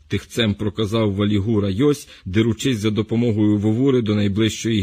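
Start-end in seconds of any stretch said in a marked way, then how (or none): none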